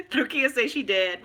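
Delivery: ordinary talk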